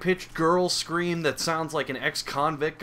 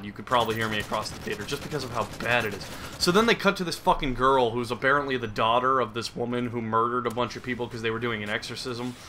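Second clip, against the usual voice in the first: normal talking voice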